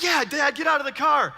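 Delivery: put-on egging Dad on like his thrilled kids; a kid's gleeful taunt; excited kids. Kids cheering dad out of car.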